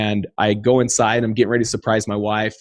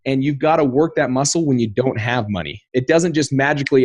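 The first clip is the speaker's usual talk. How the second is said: ordinary talk, nothing marked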